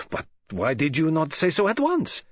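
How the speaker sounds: light, baffled reproach; taken aback. Acted. Detective challenges his friend's late confession, taken aback.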